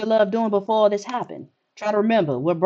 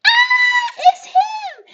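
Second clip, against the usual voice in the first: sudden high yelp of surprise; startled shout; 6 dB louder, much slower than usual. Real, not acted. Player spotting the visitor at the door, startled shout. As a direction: jump at the sight and shout.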